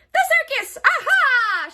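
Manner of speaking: high, loud triumphant hag; excited witch shout. A narrator's reading. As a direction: leap on the idea. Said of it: "she shouted with a jump".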